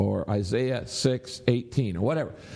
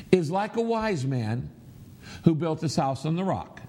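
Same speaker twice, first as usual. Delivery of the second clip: verse broken into natural phrases with clear pauses; 4 semitones higher than usual. Shown as a demonstration. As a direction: stop after each phrase, keeping it higher-pitched. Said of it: "let's read it aloud this way".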